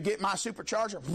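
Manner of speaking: ordinary talk, nothing marked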